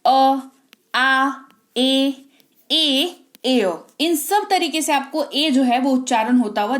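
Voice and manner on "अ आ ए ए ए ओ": each vowel sound said slowly and clearly on its own; vowel sound demo. Shown as a demonstration. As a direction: sound out अ, आ, ए, ओ one by one. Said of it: "ए आपको ये सारे तरीकों से उच्चारण होता". (स्वर की ध्वनियाँ अलग अलग बोलकर दिखाना)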